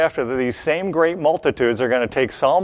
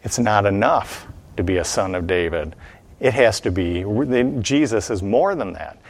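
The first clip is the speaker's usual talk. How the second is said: normal talking voice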